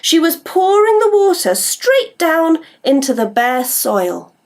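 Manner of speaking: plain reading voice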